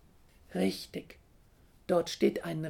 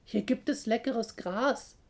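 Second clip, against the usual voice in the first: higher, louder coaxing voice; luring; 5 semitones higher, 5 dB louder than usual. A narrator's reading. Little hare to the chick in the egg, luring. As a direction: tempt the chick out. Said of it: "lockt der kleine Hase".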